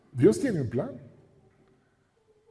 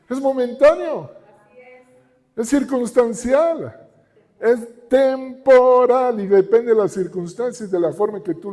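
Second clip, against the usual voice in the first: higher, louder impassioned preaching; fervent preaching; 5 semitones higher, 3 dB louder than usual. Acted. Pastor urging the congregation that trials are temporary, fervent and higher. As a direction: drive the message home with fire.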